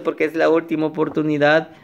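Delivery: ordinary delivery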